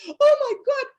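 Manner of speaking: high, loud gasp of mock horror; shocked exclamation. Daughter sees her mom's pants at her ankles, shocked. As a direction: cry it out, scandalized and high-pitched.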